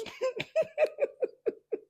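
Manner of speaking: shrill high burst of disbelieving laughter; incredulous high laugh. Real, not acted. Host recalling a guest insulting the Celtics, high incredulous laugh.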